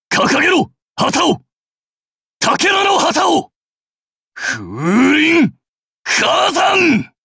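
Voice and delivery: very high full-force shout; war cry at the top of the range; rallying cry. Acted. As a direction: roar the order to the troops.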